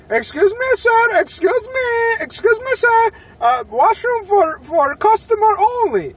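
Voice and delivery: high, slow imitation of the nervous worker; a worker stopping a customer from the washroom, in a high, drawn-out mocking voice. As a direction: squeak out the polite request in a put-on voice. Mocking the Subway worker's request.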